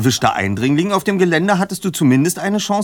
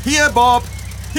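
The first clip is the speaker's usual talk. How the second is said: yelling across the room; 10 semitones higher than usual. Real, not acted. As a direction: shout to get his attention far off. Calling out to Bob's car.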